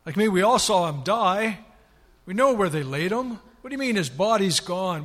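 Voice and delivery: as a doubting disciple scoffing at nonsense; loud disbelief. Acted. Disciples doubting Mary's report.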